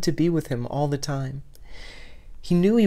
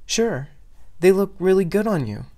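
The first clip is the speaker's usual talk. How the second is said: higher, louder, bolder compliment; 6 semitones higher, 3 dB louder than usual. Acted. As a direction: push the compliment with new courage. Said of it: "trying a little more boldly".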